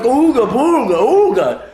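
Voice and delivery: high, loud goofy nonsense; clumsy baby giraffe. Acted. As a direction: blurt it like a silly floundering animal. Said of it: "giraffe try to walk for the first time".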